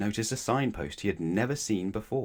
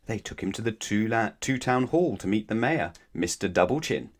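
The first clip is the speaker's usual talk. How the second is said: usual speaking voice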